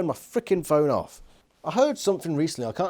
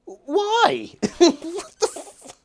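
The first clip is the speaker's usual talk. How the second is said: exasperated disbelief; 4 dB louder, much slower than usual. Real, not acted. Ranting about Visser's choices.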